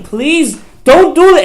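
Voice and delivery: high, loud, slow crying plea. Retold or imitated. Yosef pleading with his brothers, a high slow crying plea. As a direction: cry out to the brothers to stop. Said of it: "he's crying".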